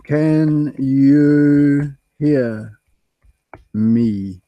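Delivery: very slow, each word stretched out; drawn-out sound check. Real, not acted. Streamer checking whether viewers can hear, very slowly. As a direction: stretch every word with gaps, as if testing a bad line.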